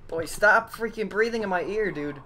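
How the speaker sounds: creeped out. Real, not acted. Monster breathing in ear.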